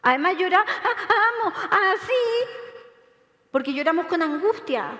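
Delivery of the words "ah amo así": high, blubbering words through fake crying; mock sob-talking. Shown as a demonstration. Speaker shows the audience crying while talking, mock sobbing. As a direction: demonstrate trying to talk while sobbing. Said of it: "Es súper difícil hablar y llorar al mismo tiempo".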